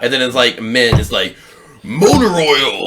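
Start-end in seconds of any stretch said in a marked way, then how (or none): 1.8-2.9 booming mock ad voice. Mocking men's product scents.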